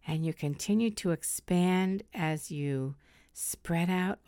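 slow, soft meditation voice; soft spa whisper. Acted. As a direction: guide them into calm, slowly. Leads a guided meditation.

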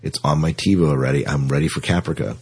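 ordinary talk

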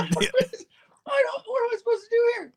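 very high, strained half-laughing cry; mock panic. Friend lighting a joint while police circle, high panicked laughing wail. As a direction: wail in helpless disbelief.

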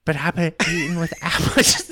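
put-on high, laughing little-girl whine; lightly mock a childish woman. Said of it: "And she goes".

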